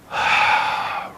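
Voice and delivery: long, voiceless exhaling sigh; sigh of relief. Shown as a demonstration. Teacher shows the class how to release tension, sigh of relief. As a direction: let tension out on an audible breath. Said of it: "using a sigh of relief".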